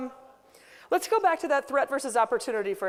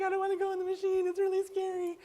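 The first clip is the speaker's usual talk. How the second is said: put-on higher, small child's voice, fast and frightened; scared little girl; 6 semitones higher than usual. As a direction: voice a crying kid afraid of the machine. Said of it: "He's like".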